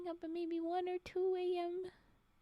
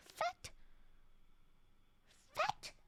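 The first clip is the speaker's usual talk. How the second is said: mock indignant; 4 dB quieter than usual. Mock accusing a viewer.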